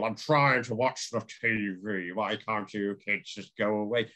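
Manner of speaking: imitated slow American southern drawl. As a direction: draw the words out like a grumbling Southerner. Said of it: "he's talking in a southern drawl".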